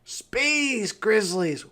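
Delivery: high, slow, triumphant announcement; excited reveal. Acted. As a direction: ring it out like a big reveal. Revealing the answer with glee.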